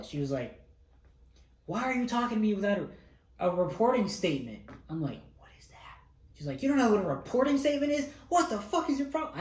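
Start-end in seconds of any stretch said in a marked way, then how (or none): 1.7-4.6 much higher, louder and fast imitated rebuke; imitated instructor scolding. MTI scolding recruit. 5.4-6.0 barely audible whisper. Retold or imitated. Scared recruit answering instructor. 6.6-9.4 much higher, louder, fast put-on instructor scream; imitated screaming instructor. MTI screaming in recruit's face.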